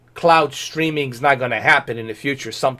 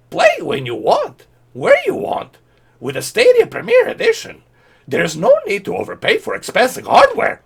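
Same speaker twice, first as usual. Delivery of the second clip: very high squeaky mock Yoda reading; 3 dB louder than usual. Mocking Google's tweet, squeaky Yoda voice. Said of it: "We gotta do this in a Yoda voice".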